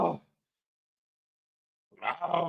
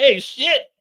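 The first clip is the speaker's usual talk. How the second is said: startled yelp; 10 semitones higher, 7 dB louder than usual. Retold or imitated.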